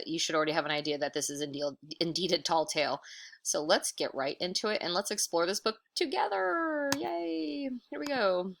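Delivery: usual speaking voice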